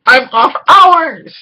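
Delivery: high, loud and slow, proudly declaring; gleeful off-duty shout. Retold or imitated. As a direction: belt it out like he's finally free to drink. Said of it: "Steel's like".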